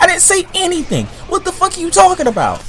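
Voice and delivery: very high-pitched acted protest; indignant retort. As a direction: replay the argument, voice shooting way up. Acted indignant denial in argument.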